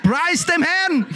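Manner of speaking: lightly voiced player praising God; footballer's praise. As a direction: sing it out with delight. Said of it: "Der hat gesagt".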